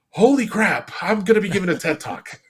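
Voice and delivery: excited disbelief. Retold or imitated. News of being picked.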